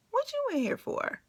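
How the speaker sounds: high, small nervous voice; timid question. Retold or imitated. Nervously asking the old lady her crime, small high voice. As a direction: ask it meekly in a tiny high voice.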